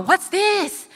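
high, puzzled question; baffled. Retold or imitated. Asking parents about the huge bags, high and baffled. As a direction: rise up in confusion. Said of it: "And I was like".

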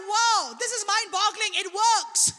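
high, loud retold astonishment; amazed. Preacher reliving his first healing miracle, amazed.

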